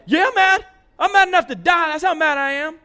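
very loud, high, raging reply; furious shout. Acted. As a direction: scream your anger at God. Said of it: "He's mad".

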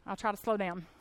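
usual speaking voice

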